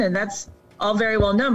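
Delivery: ordinary talk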